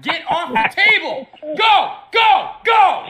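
high, loud shout at the cat; shooing shout. Real, not acted. Owner shouting at his cat to get off the table.

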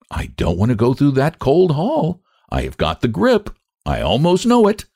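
a grumbling spinster avoiding the cold hall; Lottie's voice. Acted. Lottie's complaining dialogue.

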